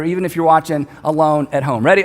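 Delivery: usual speaking voice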